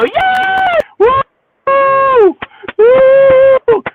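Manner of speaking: high, loud mock cheering like a crowd; imitated crowd cheer. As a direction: shout the woos up high like fans. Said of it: "we love to see the people go".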